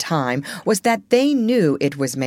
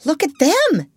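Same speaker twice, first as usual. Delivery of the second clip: high, loud exclamation; shocked cry; 11 semitones higher, 5 dB louder than usual. A narrator's reading. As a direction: cry out at the sight. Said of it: "he cried".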